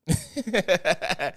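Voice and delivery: high run of laughter; real amused giggling cackle; laughing. Real, not acted. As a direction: laugh at a fond memory. Laughs at club memory.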